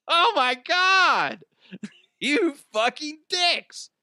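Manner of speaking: very high, drawn-out exclamation; exasperated outrage. Retold or imitated. Clerk cursing bosses over fake cameras, high exasperated outrage. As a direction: cry it out slowly, high and incredulous.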